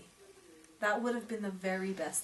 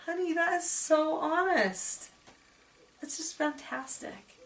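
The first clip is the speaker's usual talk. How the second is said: tender praise; 8 semitones higher, 4 dB louder, slower than usual. Real, not acted. Praising child's honesty.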